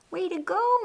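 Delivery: bright, high burst of praise; proud sister cheering her big brother on; cheery praise. Acted.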